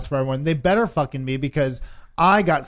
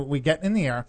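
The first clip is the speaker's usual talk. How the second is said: ordinary talk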